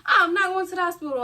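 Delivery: retold refusal. Teen to her friend, refusing to go to hospital, high and fast. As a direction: blurt it out high and quick. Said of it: "y'all, I was like".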